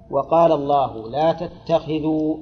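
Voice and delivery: slow, drawn-out Quran quotation; measured reading of sacred text; scripture recitation. Acted. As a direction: recite the verse with long vowels, unhurried. Quotes scripture after "God said.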